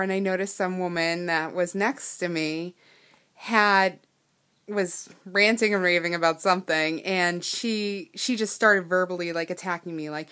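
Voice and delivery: ordinary talk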